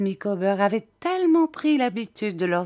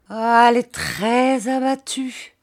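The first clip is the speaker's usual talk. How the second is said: higher, emphatic lament; dejected lament; 6 semitones higher, 4 dB louder than usual. A narrator's reading. Husband reporting his wife's state to a friend, lamenting. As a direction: sigh out the bad news, pitch raised. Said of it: "dit monsieur Micawber en secouant la tête".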